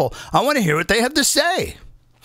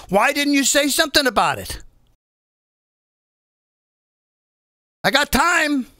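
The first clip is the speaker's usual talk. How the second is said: raised, insistent grilling of reporters; indignant confrontation; 4 semitones higher than usual. Acted. Host playing spokesperson confronting the press, indignant. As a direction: demand an answer in a higher voice, leaving pauses.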